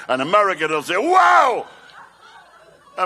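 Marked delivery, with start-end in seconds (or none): 1.0-1.7 high, loud "Wow!" shout; mock amazement. Lottery winner shouting in amazement, high and loud. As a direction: yelp it like a stunned winner.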